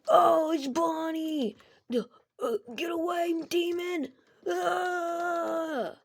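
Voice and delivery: high, loud, wailing mock scream; hammed-up terror. Michael to Bonnie at the door, hammed-up terror. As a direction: shriek in play panic, dragging out the words.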